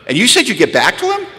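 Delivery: high, rising disbelief; mock outraged sponsor question; incredulous questioning. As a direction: throw it back at them, scandalised. Incredulous sponsor interrogates, acted.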